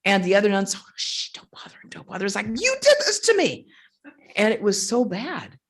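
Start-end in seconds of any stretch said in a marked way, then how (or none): 0.8-2.3 soft, whispered shushing; hushing whisper. Retold or imitated. Other nuns hushing her around him, imitated whisper. 2.5-3.7 high, loud retold accusation; furious. Injured nun answering the hushing nuns, acted furious. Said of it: "I was furious with him".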